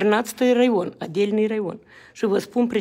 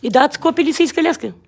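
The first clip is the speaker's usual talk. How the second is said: loud, outraged rhetorical question; indignant question; 5 semitones higher, 7 dB louder than usual. Real, not acted. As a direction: throw the question at them in anger. Angry question to officials. (întrebare indignată, tare)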